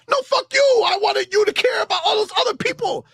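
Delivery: hammed-up high, crying shout; doll voice as fan. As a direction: shriek at the other doll like a tantrum. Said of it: "I'm playing with imaginary dolls".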